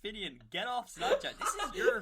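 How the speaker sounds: loud, high, laughing telling-off; mock-outraged scolding. Real, not acted. Host catching co-host on Snapchat, loud laughing outrage. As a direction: scold him in amused disbelief.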